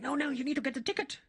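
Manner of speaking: high, fast insistent attendant voice; insistent cleaning lady. Retold or imitated. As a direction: rattle it off like an impatient attendant.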